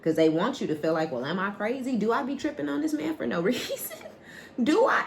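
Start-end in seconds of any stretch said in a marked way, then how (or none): 1.1-5.0 mock self-doubt. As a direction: pitch climbing high in disbelief. Acting out someone doubting their sanity.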